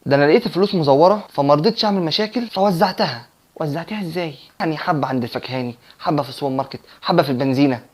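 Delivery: soft, secretive retold admission; hushed confession. Coworker admitting she passed fake notes around shops, mock hushed. Coworker confides passing on fakes.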